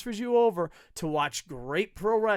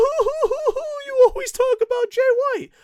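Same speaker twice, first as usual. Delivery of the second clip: much higher, louder, slow whining mock fan; whiny fan; 10 dB louder than usual. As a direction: whine like a crybaby fan. Mocking whiny fans.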